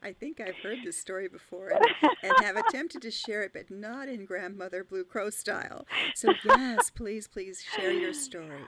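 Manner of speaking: bursting with loud delight, laughing into it; loud, high and thrilled, begging for the story; excited. Real, not acted. Friend begging to hear the story, loud excited delight.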